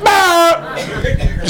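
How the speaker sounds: high yelling at his friend; mock desperate shout. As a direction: shout it out in alarm. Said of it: "he was literally just like".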